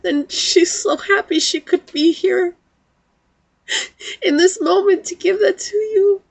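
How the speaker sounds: real tears in a high voice; high-pitched, tearful and smiling; smiling and crying. Real, not acted. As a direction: pass on loving words through happy tears. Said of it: "smiling and crying at the same time".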